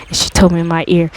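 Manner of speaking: usual speaking voice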